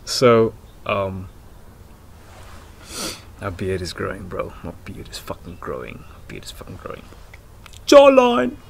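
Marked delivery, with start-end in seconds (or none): 3.4-7.0 low, very soft mutter; quiet aside. Real, not acted. Vlogger to himself about his beard, quiet aside. 7.9-8.6 high, loud shout; playful call-out. Pretend. Vlogger showing off his jawline, playful high shout.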